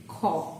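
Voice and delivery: "Kha" with a breath after the k; Kha pronunciation. Shown as a demonstration. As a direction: say "Kha" as aspirated k plus an open o. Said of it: "it will be pronounced as".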